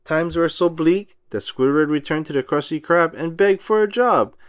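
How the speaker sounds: plain reading voice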